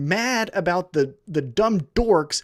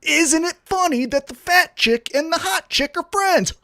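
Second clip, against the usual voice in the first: high, sarcastic sing-song, ending in a laugh; sneering high mock, then a derisive laugh; mocking storyline pitch; 9 semitones higher than usual. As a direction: parody the writers' cheesy premise. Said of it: "the whole fucking story is".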